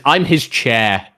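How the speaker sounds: slow, stressed I'm his chair; slow readout. Shown as a demonstration. Streamer reads a chat message aloud, slow and drawn out. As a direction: drag out "his" slowly.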